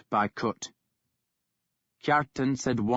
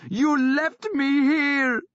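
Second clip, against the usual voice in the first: slow, shrill-high, much louder accusing scream; furious scream; 5 dB louder, slower than usual. A narrator's reading. Sister screaming at her brother for leaving her, furious. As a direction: hurl it at him, wild with grief. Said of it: "she screamed at him".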